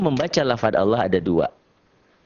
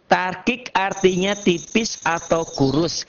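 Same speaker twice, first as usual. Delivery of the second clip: higher, slower thin-toned definition; slow, raised-pitch demonstration; 7 semitones higher, slower than usual. Shown as a demonstration. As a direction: stretch it out in a high, thin voice. Mimics thinness against gemuk.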